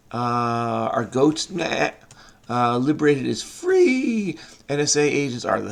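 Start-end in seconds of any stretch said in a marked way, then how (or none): 1.5-1.9 short goat bleat, a bit higher. Pretend. Puzzle solver naming goats, mock goat bleat. 3.4-4.4 playful cry of freedom. Pretend. Draws out the answer "free.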